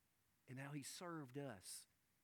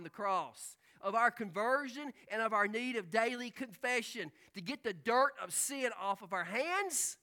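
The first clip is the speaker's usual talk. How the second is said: loud, rising preacher's voice, slowing on key words; preaching at full force; 6 semitones higher, 10 dB louder than usual. Acted. Pastor preaching the Lord's Supper, loud and impassioned. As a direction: drive it home to the congregation.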